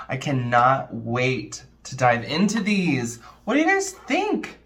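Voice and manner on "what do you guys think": excited question. Real, not acted. Asking viewers excitedly.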